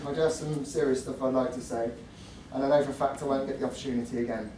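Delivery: ordinary talk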